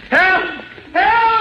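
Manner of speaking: high-pitched panicked call for help; cry for help. Acted. Doctor calling for help as Superman holds him, panicked cry. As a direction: yell out, pitch leaping way up.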